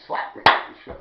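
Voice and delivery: normal talking voice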